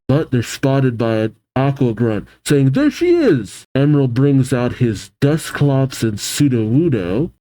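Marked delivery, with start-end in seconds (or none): 2.7-3.7 high shout of discovery; calling out. Acted. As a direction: yell it as you spot her. Grunt spots her, calling.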